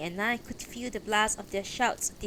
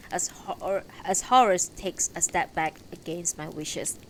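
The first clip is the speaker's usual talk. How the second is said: ordinary delivery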